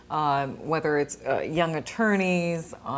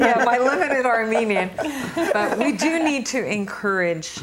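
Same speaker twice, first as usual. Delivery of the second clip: laughing; 6 semitones higher, 5 dB louder than usual. Real, not acted. Host still laughing at herself.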